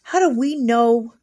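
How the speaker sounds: usual speaking voice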